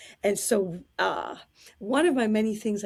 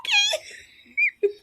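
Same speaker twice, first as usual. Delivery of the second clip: squeaky, much higher okay through laughter; laughing okay; 3 dB louder than usual. Retold or imitated. Stunned helper answering the diva, high laughing okay. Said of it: "And I was like".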